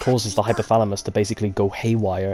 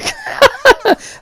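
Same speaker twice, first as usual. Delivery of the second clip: high laugh; 11 dB louder than usual. Real, not acted. Laughs at own accent.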